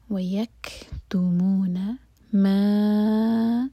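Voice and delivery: مَا stretched very long, four counts; long madd. Shown as a demonstration. Teacher shows the long آ in مَا to a student, drawn out. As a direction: drag the آ of مَا out slowly. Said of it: "چار حرکہ لمبا".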